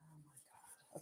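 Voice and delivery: breathy whispered gasp. Real, not acted. Reacting to the messy cut, whispered. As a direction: whisper it under your breath.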